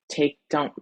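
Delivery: usual speaking voice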